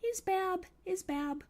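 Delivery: put-on high squeaky baby talk; high cutesy couple talk; couple's silly voice. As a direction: squeak it out like a private joke. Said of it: "we talk like this".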